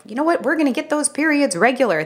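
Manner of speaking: high, lively retold pitch to a patient; upbeat announcement. Clinicians telling a patient their plan, upbeat.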